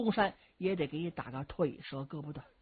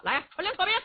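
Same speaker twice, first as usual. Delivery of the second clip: high-pitched, abrupt command in a huff; huffy; 11 semitones higher, 4 dB louder, much faster than usual. A narrator's reading. Strategist sulkily orders the troops to withdraw, huffy. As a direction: order it crossly. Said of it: "徐茂公一赌气不理他了".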